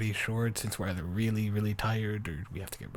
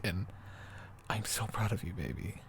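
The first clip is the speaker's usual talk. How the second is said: ordinary delivery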